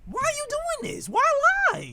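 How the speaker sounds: high-pitched squeal of disbelief; exasperated plea. Real, not acted. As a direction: plead in a voice shot way up high.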